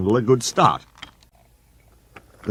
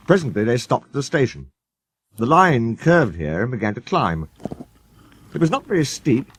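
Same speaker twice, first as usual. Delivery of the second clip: ordinary delivery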